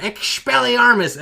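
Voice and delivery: high, loud, drawn-out incantation; mock spell yell. Harry yelling in the chat to disarm his enemy, mock yell. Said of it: "He just yells out in the chat".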